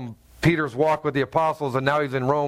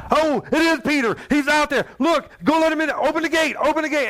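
excited yelling; 9 semitones higher than usual. Retold or imitated. Disciples urging to open gate, excited high shout. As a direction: shout it like they can't wait.

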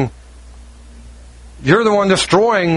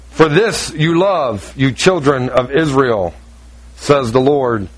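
solemn close; 6 semitones lower, slower than usual. Acted. Scripture ending slowed and low.

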